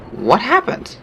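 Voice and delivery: high, startled question; sudden disbelief at the kill; baffled what happened; surprised. Real, not acted.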